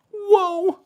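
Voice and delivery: very high falsetto whoa; squeaky-high impression of a TV catchphrase; Joey Lawrence whoa. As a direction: imitate a sitcom catchphrase way up high. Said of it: "And then Joey Lawrence goes".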